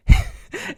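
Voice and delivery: sudden high-pitched laugh; short laugh. Real, not acted. Teller reacts to naked cartwheels, short high laugh. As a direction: burst briefly into laughter. Laughs at his own story.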